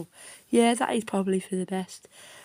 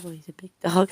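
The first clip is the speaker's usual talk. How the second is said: normal talking voice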